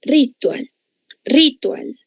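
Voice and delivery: "ritual" stressed on the first syllable, RÍ-tual; misplaced stress. Shown as a demonstration. Teacher testing a student's wrong answer, "ritual" said RÍ-tual. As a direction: hit RI high in "ritual" and let tual drop. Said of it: "¿Así pronunció?".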